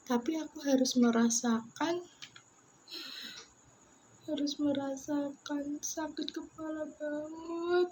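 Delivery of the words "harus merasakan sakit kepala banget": softer, close to crying; holding back tears while recalling the headaches; near tears. Real, not acted. Telling viewers about the pain, softly near tears. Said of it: "aku pengen nangis".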